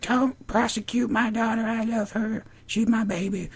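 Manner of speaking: mock pleading mother in falsetto; Daisy. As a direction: imitate a protective mom, very high. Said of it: "She's like".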